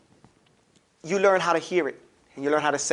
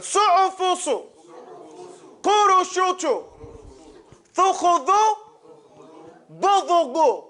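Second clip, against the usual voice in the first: higher, clear chanted drill words; recitation drill; 10 semitones higher, slower than usual. Shown as a demonstration. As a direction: call each word for the class to echo. Class drill continues.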